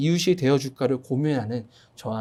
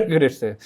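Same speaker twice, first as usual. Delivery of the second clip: laughing; 3 semitones lower than usual. Real, not acted.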